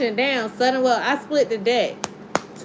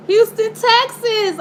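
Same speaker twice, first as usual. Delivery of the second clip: high, loud, drawn-out city name; excited call; 9 semitones higher, 4 dB louder, slower than usual. Real, not acted. Tarot reader calling out a location, excited. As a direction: announce it with a thrill.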